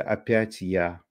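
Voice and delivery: mock embarrassment; embarrassed. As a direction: imitate a bashful winner, drawn out and soft. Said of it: "он так засмущался".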